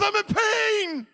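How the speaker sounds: loud, high holler of pain; pained yell. Retold or imitated. Injured worker answering his coworker, pained yell. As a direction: yell back at him, hurting.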